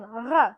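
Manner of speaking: ra with a gargled French r; French r sound. Shown as a demonstration. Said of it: "like a French R".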